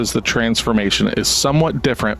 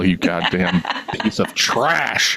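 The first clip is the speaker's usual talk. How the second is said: slow, drawn-out mock contempt; hammed-up disdain read from a review; mock scorn; 4 semitones lower, slower than usual. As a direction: sneer it out slowly like a petty critic. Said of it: "I'm gonna say so much in this that I don't believe".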